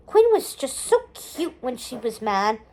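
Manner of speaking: ordinary delivery, nothing marked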